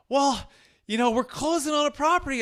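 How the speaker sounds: lightly imitating a Boston client; Boston accent. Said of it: "I can't even do the Boston accent".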